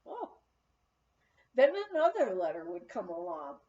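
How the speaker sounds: plain reading voice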